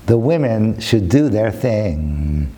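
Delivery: slow and low, stretching the last word; slow, weighty repeat. Real, not acted. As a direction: draw the words out slowly. Repeats line slowly for effect.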